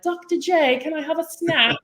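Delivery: put-on sweet little-kid voice asking for a snack; little son's voice. Youngest son walks up to his mom, high child voice.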